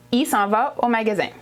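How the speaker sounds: 'il' said as 'ee' with no L; Quebec contracted il. Shown as a demonstration. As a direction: drop the L so 'il' is just 'ee'.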